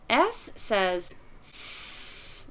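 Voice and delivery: "S" drawn out as a long voiceless hiss, sss; letter sound demo. Shown as a demonstration. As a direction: say "S says S" slowly, hissing the S. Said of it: "because B says B".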